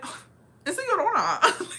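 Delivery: high, loud hammed-up cry; mock exclamation. Locals realising it's La Llorona, hammed-up exclamation. Locals' reaction, acted out before laughing.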